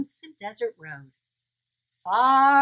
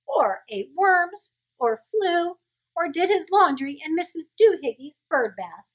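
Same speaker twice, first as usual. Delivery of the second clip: high scolding mother; 6 semitones higher, 4 dB louder than usual. A narrator's reading. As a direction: scold him like a fed-up mama cat. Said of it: "she scolded".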